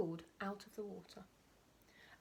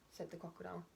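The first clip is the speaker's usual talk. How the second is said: ordinary delivery, nothing marked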